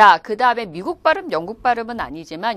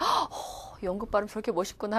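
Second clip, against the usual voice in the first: soft gasp of awe; hushed, quick breathy admiration; admiring student; 10 dB quieter than usual. Retold or imitated. Student swooning over British pronunciation, hushed admiring gasp. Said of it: "두 부류의 학생들이 있어요".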